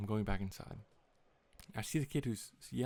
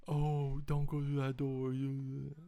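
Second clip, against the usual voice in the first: higher mock teasing friend voice; sing-song it like a teasing buddy; 4 semitones higher, 4 dB louder, slower than usual. Friends tease him walking through the building, mock voice. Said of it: "my friends are like".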